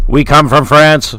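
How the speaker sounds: loud, slow declaration. Acted. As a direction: announce it grandly. Joke in-character line.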